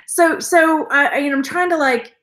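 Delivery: usual speaking voice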